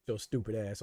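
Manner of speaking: low, soft put-down; muttered insult. Real, not acted. Streamer muttering at a viewer, low and soft. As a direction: drop it under your breath.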